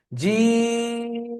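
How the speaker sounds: slow, sustained recitation of জিম; three-alif stretch. Shown as a demonstration. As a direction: stretch the ee of জিম steadily for several beats. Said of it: "তো জিম তিন আলিফ টান দিলাম".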